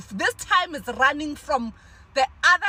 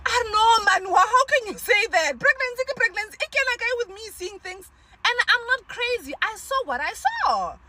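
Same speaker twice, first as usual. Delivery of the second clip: indignant protest; 7 semitones higher than usual. Retold or imitated. Mother to dismissive helper, replaying her indignant, teary protest. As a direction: push back hard, voice cracking at first.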